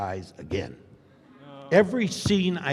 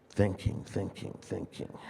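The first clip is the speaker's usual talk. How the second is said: fading murmur; 4 semitones lower, 6 dB quieter than usual. Shown as a demonstration. Demonstrating silent thinking.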